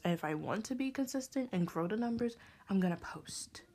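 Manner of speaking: ordinary talk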